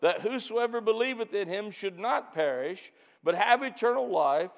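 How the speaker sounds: loud, high, fervent sermon voice; full-force preaching. Acted. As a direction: preach it out to the congregation with fire. Preacher reading and exhorting.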